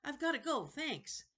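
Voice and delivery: put-on a kid wanting to get out fast; boy blurting an excuse to leave; hasty boy. Jonathan voiced, hurrying off.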